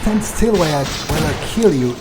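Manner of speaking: real angry shout at a dodging enemy; furious yell. Real, not acted. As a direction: yell at him to hold still. Shouting at enemy mid-fight.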